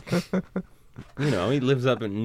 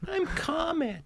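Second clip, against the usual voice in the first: very high, soft mock voice; dainty high reindeer voice; Comet; 7 dB quieter, slower than usual. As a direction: squeak it meekly in a tiny falsetto. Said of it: "makes fun of Comet for his stupid name".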